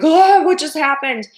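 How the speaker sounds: high, loud startled cry; jolted by a rear-end crash; startled after a crash. Retold or imitated. Driver just rear-ended, loud startled outburst. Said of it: "you're like".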